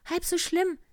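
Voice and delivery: a kindly little bear voice, high and loud. Acted. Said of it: "sagte der kleine Bär".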